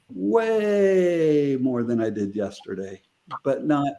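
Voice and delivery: "way" held long, sliding slowly down; slow, drawn-out stress on "way"; emphatic loving declaration. Shown as a demonstration. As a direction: stretch WAY right out. Models the declaration for listeners.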